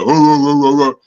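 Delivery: high, loud mock laugh; mocking siblings' laughter. Imitating siblings who laughed at his childhood voice, mock laugh. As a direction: cackle like teasing kids. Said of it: "they'd be like".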